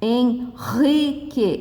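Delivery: slow "Henrique", hen, then guttural ri; strong R. Shown as a demonstration. Teacher giving an example, slow with strong R. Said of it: "R forte".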